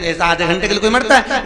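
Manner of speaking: mock-indignant merchant asking; seth's disbelief. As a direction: scoff at the idea of dying. Seth questioning the saint.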